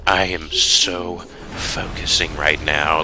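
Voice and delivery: low, very slow, soft mock concentration; mock focused calm. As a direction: drag it out like a too-calm action hero. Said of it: "a hundred percent like".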